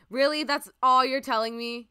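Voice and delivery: loud, high, incredulous protest; indignant outburst. Real, not acted. Streamer protesting how little the exhibit says, loud indignation. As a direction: burst out in loud disbelief. Mock outrage at exhibit's scant info.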